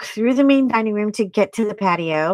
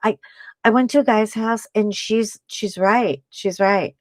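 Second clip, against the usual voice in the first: ordinary delivery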